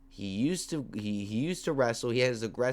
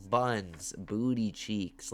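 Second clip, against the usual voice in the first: normal talking voice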